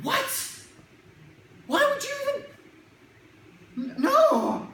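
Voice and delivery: put-on a mother horrified by her kid's question; alarmed mom. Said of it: "Mom's all excited and agitated".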